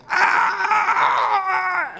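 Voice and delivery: high mock scream of pain; mock labour scream. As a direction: imitate the wife crying out in labour. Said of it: "my wife going".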